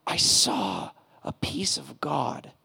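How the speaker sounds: slow awed whisper; recalling the homeless man, whispered; hushed whisper. Real, not acted. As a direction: whisper it in awe.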